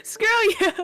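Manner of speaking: mock outcry. Mock outburst after teasing.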